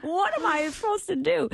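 very high mock-wailing boy; hammed-up helpless child's plea; imitating bawling child. Mother voicing her overwhelmed young son, high mock wail. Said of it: "he's bawling".

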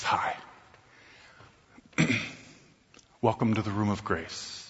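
soft, gentle welcoming hostess; beautiful hostess voice. Retold or imitated. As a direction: greet him like a kind host, softly. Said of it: "most beautiful voice that I've ever heard".

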